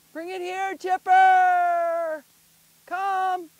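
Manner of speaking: loud, high holler, name held long; calling out. Real, not acted. Owner calling dog back with the ball, calling out.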